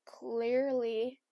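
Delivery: ordinary talk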